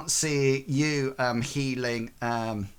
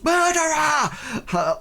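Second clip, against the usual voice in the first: high, drawn-out accusing yell; crowd shout; 14 semitones higher, 4 dB louder, much slower than usual. Acted. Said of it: "and somebody shouts".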